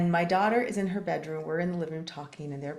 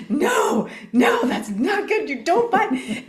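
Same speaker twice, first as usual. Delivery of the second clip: high, loud retold telling-off; shouted scolding; 10 semitones higher, 8 dB louder, slower than usual. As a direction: act out a panicked parent shouting a child down. Retold scolding of her biting daughter.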